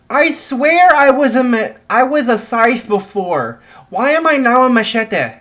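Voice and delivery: very high, loud bewildered cry; panicked protest. Acted. Son finding himself a machete, loudly bewildered. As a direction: shout in disbelief, pitch way up.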